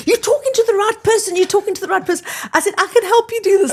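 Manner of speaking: high, fast, thrilled rush; can't contain the excitement; excited. Retold or imitated. Telling the businessman she's the right person, excitedly. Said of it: "I got so excited".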